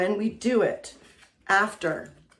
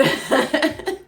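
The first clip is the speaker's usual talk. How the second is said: high breathy laugh; 8 semitones higher, 6 dB louder than usual. Real, not acted. Laughs at dog barking.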